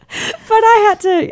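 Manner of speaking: high, slow exclaiming; high-pitched. Real, not acted. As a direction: squeak it out.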